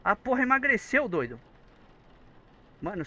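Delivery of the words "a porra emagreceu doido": loud high startled yell; frightened shout. Real, not acted. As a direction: scream out after a jump scare. Jump scare reaction.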